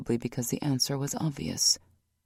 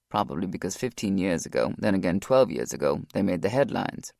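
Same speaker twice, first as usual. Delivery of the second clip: lower, steady male voice; Rock's voice; 7 semitones lower than usual. A narrator's reading. Rock explains.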